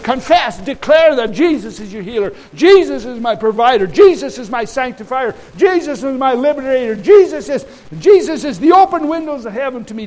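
high, loud, driving sermon delivery; full-force preaching. Real, not acted. Preacher quoting scripture to the congregation, full-force preaching.

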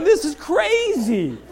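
mock fed-up rant; comic exasperation. Man fed up with a balking goat, comic exasperated outburst.

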